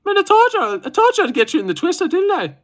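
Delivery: very high, put-on Irish voice; Irish accent. Streamer imitating a fighter gloating over a twister, high Irish accent. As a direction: squeal it in a mock Irish accent. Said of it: "But that was Irish".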